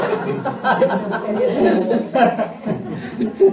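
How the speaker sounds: long high laughing fit; helpless rising laughter. Real, not acted. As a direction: keep laughing. Laughs at the reply.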